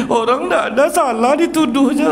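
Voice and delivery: loud, high-pitched mock child's crying; hammed-up wailing complaint of an accused child; mock tearful wail. Child tells parent of being wrongly blamed, mock crying wail. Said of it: "baru dia nangis".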